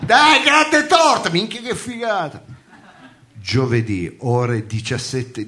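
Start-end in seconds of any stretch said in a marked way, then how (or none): 0.1-1.3 excited. Acted. Cheering friend.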